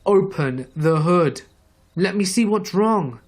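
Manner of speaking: exasperated retold yell. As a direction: repeat the order impatiently, spacing out the words. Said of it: "He yells".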